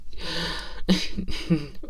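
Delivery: high, breathy embarrassed giggle; a sheepish little laugh. Acted. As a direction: laugh to cover the blunder. Embarrassed laugh after blunder.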